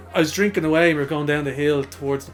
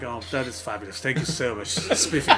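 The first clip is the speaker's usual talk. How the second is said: lower, softer put-on posh thanks; 4 semitones lower, 5 dB quieter than usual. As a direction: gush like an upper-class toff. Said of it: "put on my poshest voice ever".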